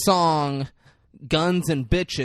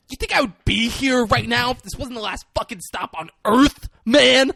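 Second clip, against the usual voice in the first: high, louder desperate outburst; desperate plea; 10 semitones higher, 5 dB louder than usual. Retold or imitated. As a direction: plead like it's the last stop. Desperate son pleading with dad.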